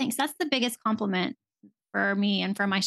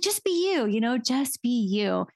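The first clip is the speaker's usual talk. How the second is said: husband's advice; 3 semitones higher than usual. Retold or imitated. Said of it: "He's like".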